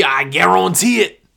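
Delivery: lightly drawled Cajun "I guarantee it"; Cajun chef voice. Shown as a demonstration. A sales rep teasing the weekly code in a Cajun chef voice. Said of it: "you remember the old chef".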